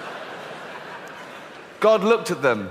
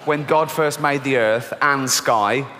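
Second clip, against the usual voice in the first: storybook reading; 2 semitones lower than usual. Acted. Rereading the corrected story line.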